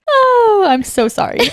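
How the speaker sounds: high, loud wail dropping into a laughing apology. Real, not acted. Host to co-host after teasing her, laughing apology. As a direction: apologize while still cracking up. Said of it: "I know I'm laughing".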